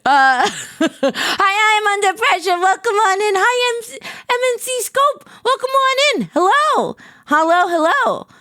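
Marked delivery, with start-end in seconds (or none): 1.4-8.3 high, squeaky excited hellos; excited greeting. Real, not acted. Streamer welcoming viewers under pressure, excited squeaky greeting.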